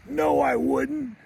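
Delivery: high, drawn-out mock little-boy retort; defiant kid. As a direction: stretch it out like a stubborn child. Angry boy defies his mother.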